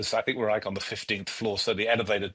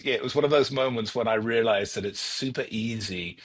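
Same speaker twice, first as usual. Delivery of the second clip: ordinary talk, nothing marked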